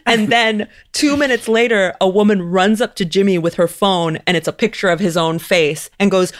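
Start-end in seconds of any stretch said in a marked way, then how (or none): none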